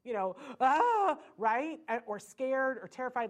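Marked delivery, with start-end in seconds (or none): 0.6-1.2 loud, high mock wail; hammed-up demo of a tantrum howl; imitated full-on wail. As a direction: belt out a child's full meltdown cry. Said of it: "doing the full on".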